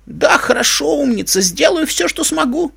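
high-pitched, eager agreement; grandfatherly, willing and high; obliging old man. A narrator's reading.

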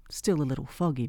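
ordinary talk, nothing marked

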